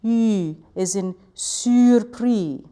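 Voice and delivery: "U" with tongue on e and lips tightly rounded, "surpris" slow; French u vowel. Shown as a demonstration. Teacher demonstrating the French u vowel. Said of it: "the one that goes".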